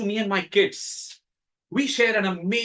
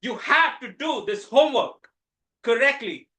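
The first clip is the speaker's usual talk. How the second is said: retold yell at son; 6 semitones higher than usual. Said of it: "I started yelling at that little kid".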